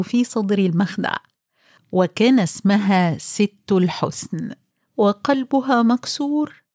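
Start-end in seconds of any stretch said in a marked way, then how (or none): none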